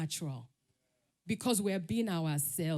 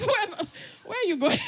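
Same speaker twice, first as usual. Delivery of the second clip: high, loud, amused disbelief; laughing self-mockery; 11 semitones higher, 7 dB louder, faster than usual. Real, not acted. Retelling own failed runaway, laughing at herself. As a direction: break up laughing while asking.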